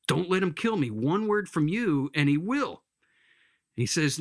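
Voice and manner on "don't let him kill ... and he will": higher-pitched urgent plea; begging. Acted. Lizard urging the ghost to stop the angel, begging. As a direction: warn and plead as the lizard. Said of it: "The lizard's begging".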